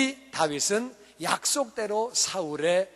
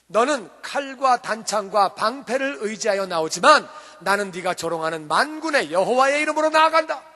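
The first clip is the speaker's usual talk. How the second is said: bold battle declaration; 2 semitones higher, 6 dB louder than usual. Acted. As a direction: declare it boldly, charging at the giant. David's battle cry to Goliath. (우렁차게 선포하듯)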